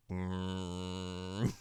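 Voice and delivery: low, soft curt "Mm"; terse grunt. Retold or imitated. Sesshomaru brushing off Kagome's question, low and soft. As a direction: answer with a flat dismissive hum. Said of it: "he's just like".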